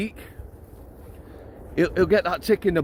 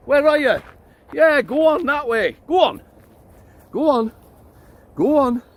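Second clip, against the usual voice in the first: higher, louder calls to the dog; calling the dog; 10 semitones higher, 4 dB louder than usual. Real, not acted. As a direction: coax him out and send him on.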